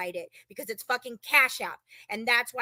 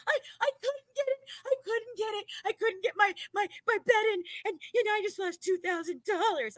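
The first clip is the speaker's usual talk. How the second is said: mock sobbing, much higher; imitating crying; 7 semitones higher than usual. Mimicking girlfriend who missed her bet, mock crying. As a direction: wail it like the upset girlfriend. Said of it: "she's fucking bawling".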